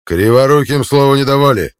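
loud, high gruff bark. A narrator's reading. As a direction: bark her down. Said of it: "гаркнул Равуда".

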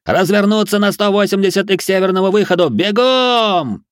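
high, sustained shouted order; commanding yell. A narrator's reading. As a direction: holler marching orders to a crowd. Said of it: "заорал он внезапно прорезавшимся командным голосом".

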